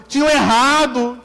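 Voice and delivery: ordinary talk, nothing marked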